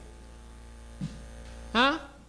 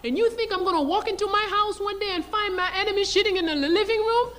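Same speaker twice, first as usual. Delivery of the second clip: furious outburst; 9 semitones higher than usual. Retold or imitated. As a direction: blast it at her like an outraged general. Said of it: "He was furious".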